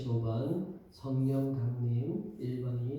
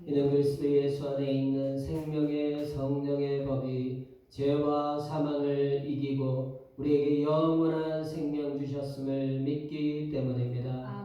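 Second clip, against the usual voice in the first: solemn, projected worship-leader prayer; formal public prayer; 2 semitones higher, 5 dB louder than usual. Real, not acted. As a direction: pray aloud for the whole congregation. Leads formal opening prayer. (예배 인도자의 엄숙한 기도조)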